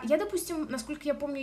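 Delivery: normal talking voice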